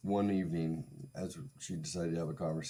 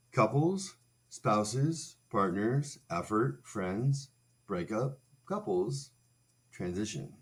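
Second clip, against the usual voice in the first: speed reading demo; 4 semitones higher, 4 dB louder, slower than usual. Shown as a demonstration. Showing how speed reading works, clipped single words. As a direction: skip along reading single words, higher and punchy. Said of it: "We're gonna read every fourth word".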